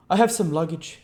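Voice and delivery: a lightly voiced curt stranger; a hint of the stranger; stranger's voice. A narrator's reading. Visitor voiced.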